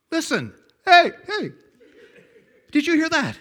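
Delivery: put-on high, loud boyish shouts; excited hollering. Boy demonstrating the echo to Mom, excited hollering.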